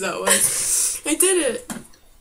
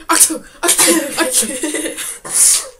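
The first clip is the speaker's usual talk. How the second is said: mock sneezes; 6 semitones higher, 5 dB louder, slower than usual. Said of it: "So it's like".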